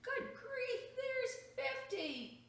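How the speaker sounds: high, loud good grief; exasperated exclamation. A narrator's reading. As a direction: cry out in dismay at the crowd. Overwhelmed mom, monsters multiplying.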